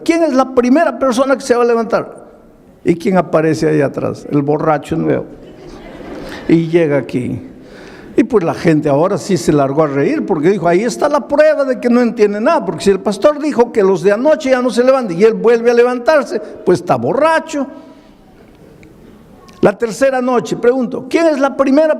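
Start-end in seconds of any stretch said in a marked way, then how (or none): 0.0-2.2 calling out. Retold or imitated. Pastor calls congregation forward. 10.7-17.7 mocking crowd. People mock the drunk.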